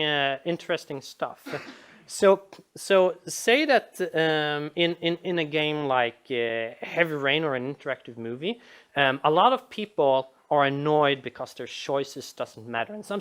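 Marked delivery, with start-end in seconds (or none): none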